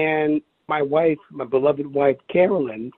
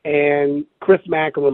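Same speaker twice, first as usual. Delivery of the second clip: ordinary talk, nothing marked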